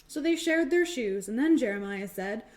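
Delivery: usual speaking voice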